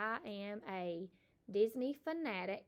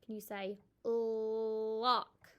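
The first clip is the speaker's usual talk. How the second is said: stretched-out word; much slower than usual. Shown as a demonstration. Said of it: "This is a picture of a lock".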